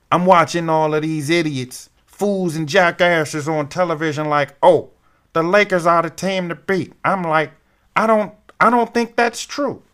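a hint of Barkley ranting; Charles Barkley voice. Host quoting Barkley on the TV pundits, light Barkley impression. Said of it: "the Charles Barkley voice reference".

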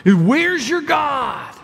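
enemy mocking the psalmist, slow sneering taunt. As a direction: taunt him like the psalmist's enemies. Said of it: "like he's speaking".

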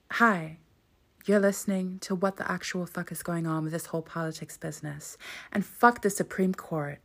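attempted American podcast intro accent. Shown as a demonstration. Host showing off to listeners, American accent. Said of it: "do you wanna hear my American accent".

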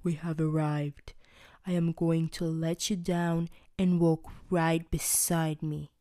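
low, booming giant voice; a deep-voiced giant, calm and commanding; big Trevor voice. Giant telling his captives he'll set them down.

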